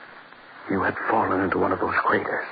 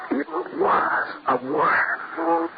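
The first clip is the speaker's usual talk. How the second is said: horrified; much slower than usual. Acted. Horrified realization.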